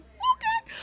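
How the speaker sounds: extremely high squeaked reply; tiny falsetto yelp of agreement; starstruck squeak. Retold or imitated. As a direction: squeak it out, giddy at the invitation.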